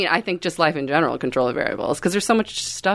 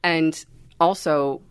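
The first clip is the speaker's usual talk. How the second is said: usual speaking voice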